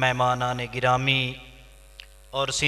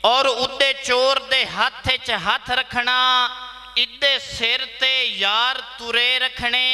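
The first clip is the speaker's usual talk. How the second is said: higher-pitched, declaimed mushaira verse; poetry recital; 10 semitones higher, slower than usual. Acted. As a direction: declaim each line to the audience, pausing between phrases. (मुशायरे विच उच्ची सुर नाल शेर)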